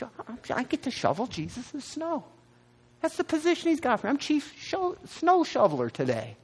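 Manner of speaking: bursting with glee at a humble chore; giddy joy. Retold or imitated. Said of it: "I was".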